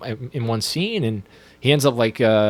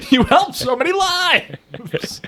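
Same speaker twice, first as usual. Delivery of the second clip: laughing impression; 12 semitones higher, much slower than usual. [name] gasping at [name] while laughing. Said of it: "[name]'s like".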